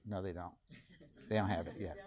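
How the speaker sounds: low, hushed contradiction; very soft, low-pitched aside; observer's quiet aside. Acted. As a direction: murmur it discreetly to your co-teacher.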